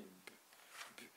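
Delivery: B breathed out voiceless; B as a tiny voiceless puff; whispered consonant sound. Shown as a demonstration. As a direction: whisper the bare sound of B with no vowel. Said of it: "son cri, c'est B".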